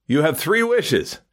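lightly voiced genie. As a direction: grant it slowly like a genie, lightly played. Genie announces the wishes.

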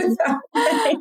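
real laughter breaking into speech; laughing echo. Real, not acted. Amused echo of guest's words.

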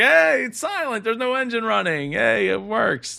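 high, hammed-up cheer; mock excited. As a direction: fake it like a proud salesman. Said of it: "And they're like".

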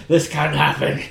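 a hint of a gruff Batman voice. Bruce Wayne slipping into his Batman voice at the party, lightly done. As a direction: lightly put on the Batman growl. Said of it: "doing your Batman voice".

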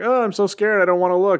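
high, hammed-up frightened girlfriend; mock scared Jane. Jane clinging to Thor, high mock-scared voice. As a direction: squeak it out like a damsel in distress. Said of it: "she's like".

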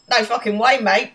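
hammed-up Australian impression; Australian accent. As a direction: belt it out as a caricature Australian. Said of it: "we permanently do this accent".

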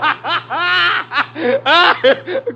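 long, high gloating laugh; wild, high peal of laughter; mocking cackle. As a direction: cackle at a victim's screams. Gunman laughs at cries for help.

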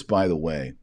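normal talking voice

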